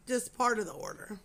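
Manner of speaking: normal talking voice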